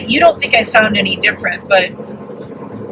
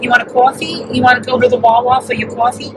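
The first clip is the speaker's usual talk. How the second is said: demonstrated New York-New Jersey accent, rounded vowels; faster than usual. Shown as a demonstration. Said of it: "I can say dog and coffee".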